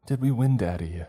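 put-on high, eager child's voice; smiling little girl. A narrator's reading. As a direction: ask it brightly with a smile. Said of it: "she said with a smile".

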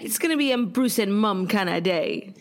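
a light put-on British accent; lightly hammed-up British lilt; mock British accent. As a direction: play at sounding British. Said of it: "I don't even know what accent it is that we're doing".

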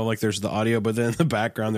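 normal talking voice

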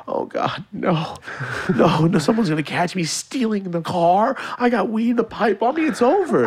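high, rising panic, voice climbing; mock terror of getting caught; teen panicking in a hammed-up high voice; panicked inner voice. Panicked self-quote, caught stealing car.

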